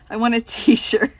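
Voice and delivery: ordinary talk, nothing marked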